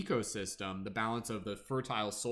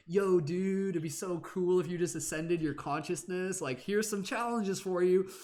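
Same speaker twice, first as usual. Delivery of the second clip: higher, chill surfer-dude offer; surfer universe; 6 semitones higher than usual. Acted. Universe offering challenges, higher surfer-dude voice. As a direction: pitch it like a stoked bro. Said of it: "It's like".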